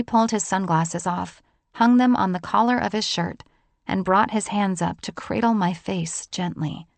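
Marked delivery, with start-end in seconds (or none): none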